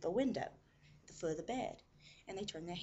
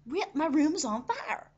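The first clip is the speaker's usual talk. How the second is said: high, loud, slow protest; urgent insistence; 9 semitones higher, 11 dB louder than usual. Retold or imitated. Mom to the nurse who won't listen, urgently insistent. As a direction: drive each word home to a disbeliever. Retold mother insisting once more.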